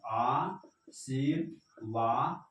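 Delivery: आशीर्वाद drawn out very slowly with a rise; slow dictation. Shown as a demonstration. (धीरे-धीरे लिखवाना)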